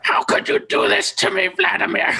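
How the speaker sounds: high screechy voice, pushed way up; squawking, high-pitched plea; screechy bird person. Shown as a demonstration. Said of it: "a screechy voice".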